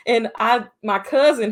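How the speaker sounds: ordinary talk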